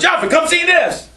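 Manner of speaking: excited call. Retold or imitated. Ham calling his brother over, high excited yell. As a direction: shout it like a kid who found something shocking. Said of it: "And Ham goes".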